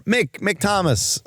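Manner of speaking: high, slow call across the gate; calling out a name. Retold or imitated. A stranger at the gate calls out to Mick, drawn-out call.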